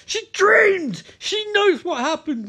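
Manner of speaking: high, loud acted outburst; mock frantic yell. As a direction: proclaim it in hammed-up agitation. Acted quarrel at its peak.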